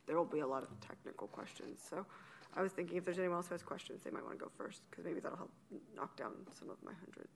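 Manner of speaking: speaking through a face mask; muffled with a mask over the mouth. Real, not acted. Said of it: "can you hear me okay through the mask?".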